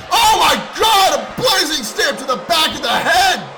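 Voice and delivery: loud, high burst of excitement; excited shout. Real, not acted. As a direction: shout the move call hyped. Commentator reacts to big move.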